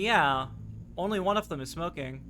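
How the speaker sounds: ordinary talk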